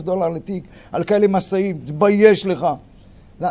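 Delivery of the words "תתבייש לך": mock scolding. Scolding the lawyer's bad play.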